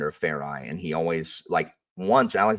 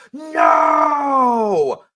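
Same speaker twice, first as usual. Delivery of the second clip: much higher, loud cry of dismay; students' dismayed shout; 9 dB louder than usual. Retold or imitated. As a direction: cry out as the hero falls. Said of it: "people are going".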